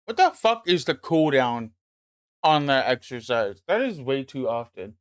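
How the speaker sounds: ordinary delivery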